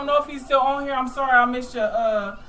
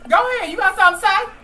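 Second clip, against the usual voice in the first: calling out; 10 semitones higher, 7 dB louder, much faster than usual. Real, not acted. Calling on a listener.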